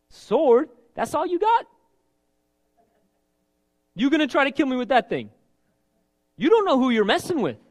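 high, loud, cocky challenge; defiant taunt. Retold or imitated. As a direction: scoff at the giant's weapons. Voicing David taunting Goliath.

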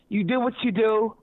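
normal talking voice

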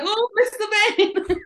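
mock the doctor's sheepish answer while laughing; doctor's reply. Doctor answering about the lump, laughing mock voice. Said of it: "He was like".

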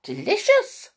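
very high little mouse squeak; high-pitched, delighted mouse voice; squeaky mouse. Acted. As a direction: squeak it out with relish like a tiny mouse. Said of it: "squeaked Squeak".

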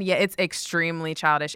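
normal talking voice

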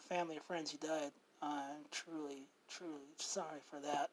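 quiet, somber and sincere; serious and somber. Real, not acted. Host turning serious for a 9/11 memory, quiet and sincere. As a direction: speak gently about a painful memory. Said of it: "gonna be kind of actually a serious one".

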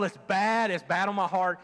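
ordinary talk, nothing marked

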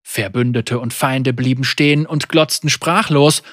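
usual speaking voice

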